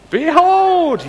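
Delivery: "Behold" swept up very high and loud, then falling; grand proclamation. Shown as a demonstration. Answering the clue, she cries "Behold" very high and loud. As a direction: boom out "Behold" like a herald, high and long. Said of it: "Second syllable sounds like hold".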